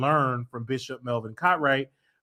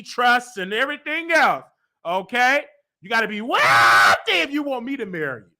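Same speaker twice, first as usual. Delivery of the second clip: higher and louder, hammed-up bragging; playful boasting; 9 semitones higher, 5 dB louder than usual. Teasing suitors about money, high and hammed-up. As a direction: play the diva listing demands. Joking demand for rich suitor.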